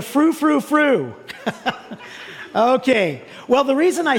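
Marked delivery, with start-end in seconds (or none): 0.0-1.1 rising frou, frou like a monkey; a hint of monkey chatter; monkey call. Shown as a demonstration. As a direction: hoot it playfully, higher at the end. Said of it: "monkeys are".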